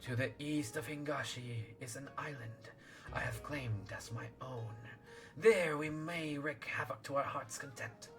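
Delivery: soft, hissing serpent lord. Acted. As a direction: breathe it like a sly sea serpent. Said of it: "I'll do serpent voice then".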